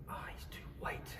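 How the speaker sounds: hushed, unvoiced aside; barely audible imitated whisper; overheard whisper. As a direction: whisper it like a muttered judgement. Overheard remark at the door.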